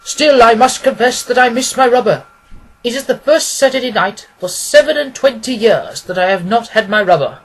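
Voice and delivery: lightly voiced old banker missing his card game; Merryweather, mournful and fussy. A narrator's reading. Merryweather to the others, mildly aggrieved complaint.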